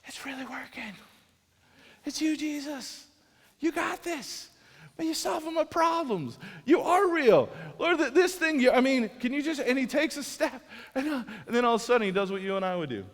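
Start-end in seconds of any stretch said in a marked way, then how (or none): 0.1-0.9 awed whisper. Retold or imitated. Peter walking on water. 2.1-9.6 rising joy as it works; thrilled. Retold or imitated. Peter thrilled walking on water.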